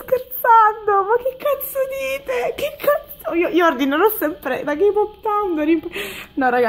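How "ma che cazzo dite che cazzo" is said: high-pitched stunned squeal; incrédula. Real, not acted. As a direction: squeal it slowly in disbelief. Stunned unboxing of a lucky card.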